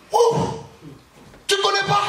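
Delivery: surprised question. Acted. Servant girl catching Peter out, in mock surprise.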